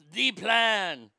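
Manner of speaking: "The plan" in a mock French accent, "plan" falling; fake French accent. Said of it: "She used a fake French accent".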